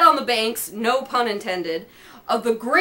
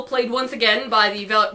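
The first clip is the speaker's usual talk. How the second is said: usual speaking voice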